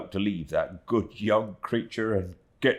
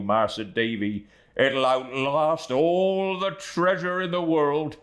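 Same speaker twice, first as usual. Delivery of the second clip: raised and slowed, a heartfelt blessing; fervent, moved; 6 semitones higher than usual. A narrator's reading. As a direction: lift it like a prayer of thanks. Fervent blessing of poor helpers.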